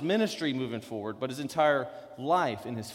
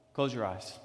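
the same words, softer and lower; softer, lower repeat; 6 semitones lower, 6 dB quieter than usual. Retold or imitated.

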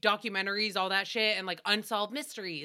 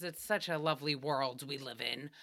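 sarcastic; 9 semitones lower, 7 dB quieter than usual. Real, not acted. Sarcasm, dropped voice.

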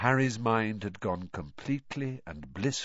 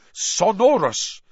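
louder, slow, commanding spell; spell incantation; 9 semitones higher, 8 dB louder, slower than usual. A narrator's reading. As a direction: cast it with flourish. Casting a spell, loud.

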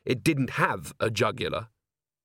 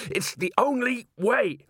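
high, slow, effortful shout; straining shout; 12 semitones higher, slower than usual. A narrator's reading. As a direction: shout it while grappling. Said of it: "Carrot shouted".

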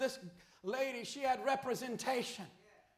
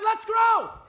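even louder and higher, ringing shout; stronger repeat; 8 semitones higher, 13 dB louder than usual. Acted. Pastor restating his call to the flock, full ringing shout. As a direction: repeat it bigger, driving it home. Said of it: "I said".

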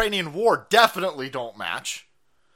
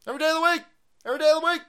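much higher, playful lilt; high sing-song; 13 semitones higher, much faster than usual. Shown as a demonstration. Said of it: "Fuck it, Putin every day".

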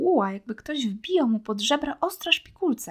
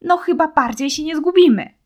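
helpless outburst; 7 semitones higher, 12 dB louder than usual. A narrator's reading. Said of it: "bezradnie zamachał rękami".

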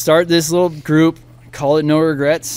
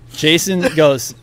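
normal talking voice

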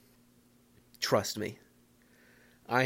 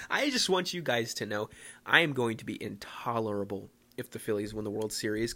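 ordinary delivery

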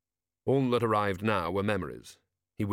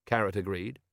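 plain reading voice

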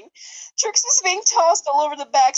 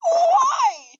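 hammed-up exclamation; 10 semitones higher, much slower than usual. Exaggerated high slow exclamation.

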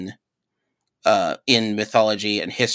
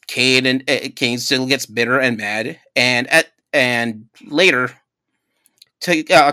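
normal talking voice

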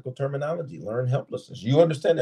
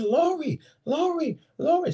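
very high, insistent imitated calling; calling a name. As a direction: act out trying to get her attention, pitched right up. Sheriff calls to screaming girl.

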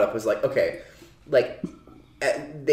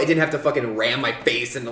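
usual speaking voice

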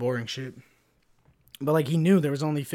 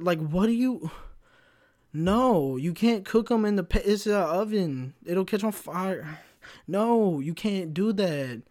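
higher, slow, drawn-out disbelief; exasperated telling-off; 6 semitones higher, slower than usual. Retold or imitated. Coworker to trainee about paper wrappers in the oven, exasperated and slow. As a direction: spell it out like he's lost his mind.

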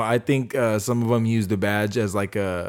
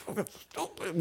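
whispered, much softer aside; under the breath; 8 semitones higher, 15 dB quieter than usual. Acted. Teasing a partner, a whispered insult under the breath. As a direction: mutter it so they barely catch it. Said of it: "I heard you say something under your breath".

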